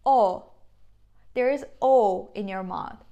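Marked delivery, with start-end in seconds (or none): 0.1-0.4 loud "O" with lips in a full circle; Turkish o demo. Shown as a demonstration. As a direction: show the round "O" mouth. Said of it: "mouth movement is like that".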